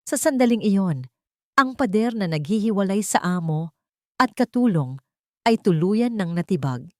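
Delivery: ordinary delivery, nothing marked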